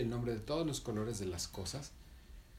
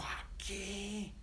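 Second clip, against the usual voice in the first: higher, soft puzzled question; high hushed question; 9 semitones higher, 7 dB quieter than usual. Acted. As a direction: Mandarina asks her friend quietly, high and hushed. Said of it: "Y Mandarina dijo".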